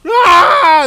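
loud high mock scream of fleeing soldiers; panicked yell. Pastor imitating the soldiers fleeing with a loud scream. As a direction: yell like a routed army.

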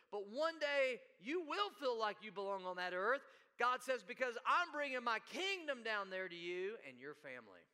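loud, high-pitched sermon climax; ringing, emphatic pulpit delivery; impassioned preaching. Real, not acted. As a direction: proclaim it to the congregation with full force. Preaches at full force.

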